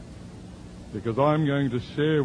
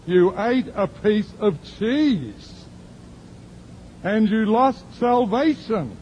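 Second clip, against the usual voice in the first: higher, louder and slow, mock shocked; mock outrage; 9 semitones higher, 4 dB louder, slower than usual. Preacher teasing the woman over her cheese sin, slow and high. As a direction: ham up the disbelief.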